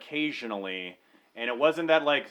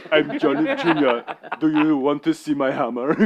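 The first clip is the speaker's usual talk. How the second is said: a lightly voiced, louder laughing hillbilly son; Johnny Junior; 6 dB louder than usual. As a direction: ham up the creepy son while laughing. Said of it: "John Junior".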